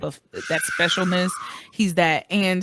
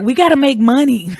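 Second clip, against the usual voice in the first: mock studio exec; 6 semitones higher, 10 dB louder than usual. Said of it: "They're just like".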